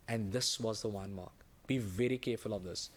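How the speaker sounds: low, hushed caution; grave warning. Real, not acted. Teacher warning students about the one mark, grave and quiet. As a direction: drop the voice low and soft to warn.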